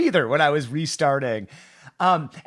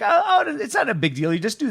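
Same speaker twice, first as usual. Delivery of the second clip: much higher, airy mock "oh, oh" brushing it off; mock breezy reassurance; 8 semitones higher than usual. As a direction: play the know-it-all who thinks it's easy. Mock quote of a breezy explainer.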